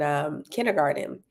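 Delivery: ordinary delivery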